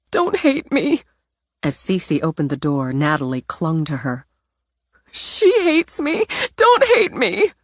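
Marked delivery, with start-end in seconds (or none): 0.1-1.0 high, begging, near tears; tearful plea. Acted. Natalie begging her grandmother not to hate her, high and tearful. 5.1-7.5 very high, rising into a wail; cling with a rising wail; desperate crying plea. Acted. Natalie clinging to Cece, desperate high wail.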